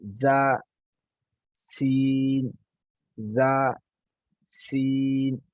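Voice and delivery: ज़ा buzzed, then सीन with a long whistling hiss, lips stretched; contrast demo. Shown as a demonstration. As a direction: alternate a buzzing ज़ा with a hissing सीन. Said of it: "बिल्कुल relax हों". (ज़ा और सीन का फ़र्क़ दिखाते हुए)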